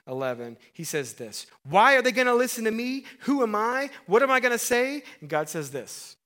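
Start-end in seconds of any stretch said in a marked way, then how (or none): 1.6-5.0 high, loud anxious pleading; pleading Moses. Acted. Moses panics at God's calling, loud and high. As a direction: Moses begging God for a way out.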